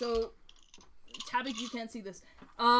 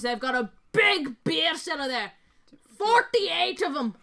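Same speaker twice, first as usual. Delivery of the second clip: boastful dwarf; 4 semitones higher, 8 dB louder than usual. Acted. Dwarf recounting a drinking feat with his brother, boastful. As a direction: brag like a hard-drinking dwarf.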